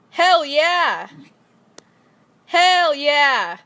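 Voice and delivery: loud, pumped-up hell yeah; mock cheer. Jokingly cheering the sex gesture, loud and pumped. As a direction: hype it up like a crowd.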